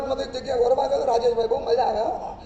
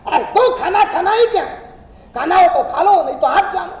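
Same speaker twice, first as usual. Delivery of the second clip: louder, nagging wife shouting for dinner; angry call; 3 semitones higher, 7 dB louder than usual. Retold or imitated. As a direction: snap it at him like a fed-up wife. Angry wife calls again.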